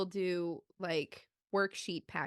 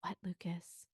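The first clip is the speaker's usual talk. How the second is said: much softer, leaning in; close quiet question; 2 semitones lower, 10 dB quieter than usual. Retold or imitated. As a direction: murmur it right at his face. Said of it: "get really close to his face".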